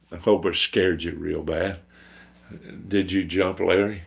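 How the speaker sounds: ordinary delivery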